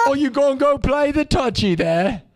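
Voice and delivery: cartoon British jab; mock British accent. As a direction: tease in a silly British voice. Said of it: "British people calling American football touchy".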